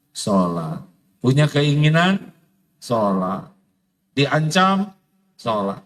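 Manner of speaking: ordinary delivery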